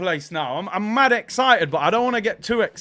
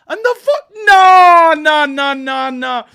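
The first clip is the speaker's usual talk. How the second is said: high, loud yell tumbling downward; disbelieving excited shout; 8 semitones higher, 12 dB louder, slower than usual. Real, not acted. Streamer rewatching a brilliant goal, disbelieving shout. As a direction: cry out in thrilled disbelief.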